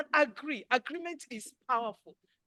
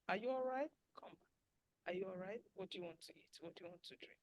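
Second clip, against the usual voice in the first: caring soft voice; 4 semitones lower, 14 dB quieter than usual. Shown as a demonstration. Caring spouse checking in.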